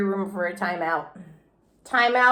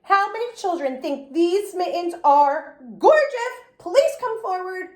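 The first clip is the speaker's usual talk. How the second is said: yelled; 7 semitones higher than usual. A narrator's reading. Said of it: "I yelled".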